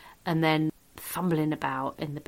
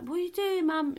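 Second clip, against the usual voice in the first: incredulous; 11 semitones higher than usual. Retold or imitated. Teen questioning mum hoovering sick.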